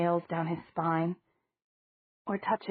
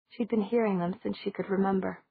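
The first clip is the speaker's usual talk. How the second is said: plain reading voice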